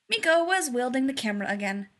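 ordinary delivery, nothing marked

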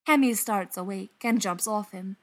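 usual speaking voice

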